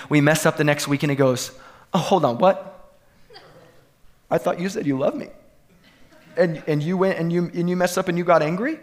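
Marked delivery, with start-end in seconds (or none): 1.9-8.8 lightly voiced bewildered God; mock naive God. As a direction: play God naively puzzled and let down. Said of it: "like he's naive or he, he doesn't get it".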